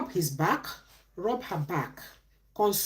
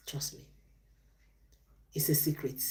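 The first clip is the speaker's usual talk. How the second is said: breathy confiding whisper, quieter; 5 semitones lower, 8 dB quieter than usual. Real, not acted. Speaker confides relationship tip to viewers, whispered. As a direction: let it slip like a secret.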